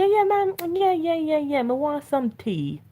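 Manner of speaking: high Caribbean lilt, imitating mom; mom in island accent. Mom answering daughter about being hungry, Caribbean accent.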